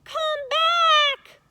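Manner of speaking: very high, loud desperate call; shouting. A narrator's reading. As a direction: call after him as he falls. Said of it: "she shouted".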